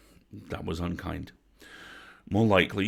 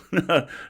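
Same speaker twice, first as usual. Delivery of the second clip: sharp high bark of a laugh, louder; one yip like a vixen; barely-a-laugh bark; 12 semitones higher, 8 dB louder than usual. Acted. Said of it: "Grandma let out a high bark".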